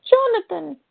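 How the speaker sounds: high, sharp mock cry of alarm; panicked cry. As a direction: call his name as the truck hits. Martha voiced as truck hits.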